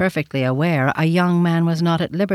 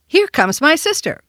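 put-on high, raised little boy's call; child crying out; 10 semitones higher, 4 dB louder than usual. A narrator's reading. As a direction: announce it in an excited boy's pitch. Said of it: "cried the child in a moment".

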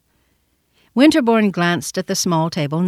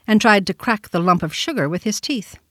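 plain reading voice

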